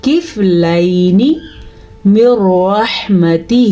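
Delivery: slow, measured tajweed recitation; careful teaching recitation; Quranic recitation. Shown as a demonstration. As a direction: recite it slowly, drawing out the vowels. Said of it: "ہم اس کو پڑھیں گے".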